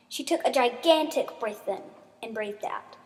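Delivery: plain reading voice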